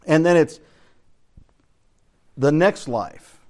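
normal talking voice

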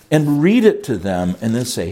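normal talking voice

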